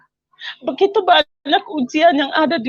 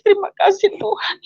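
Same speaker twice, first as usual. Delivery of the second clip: tearful, raised voice thanking God; crying thanks; 5 semitones higher than usual. Retold or imitated. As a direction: pray through tears, voice lifted high. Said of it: "Saya hanya menangis". (menangis sambil bersyukur, suara tinggi)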